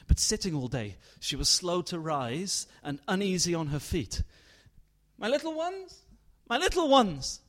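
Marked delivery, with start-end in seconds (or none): 5.2-7.4 high, louder anguished cry. Acted. Mother crying out for her children across the lake. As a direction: wail for the lost children. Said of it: "she cried".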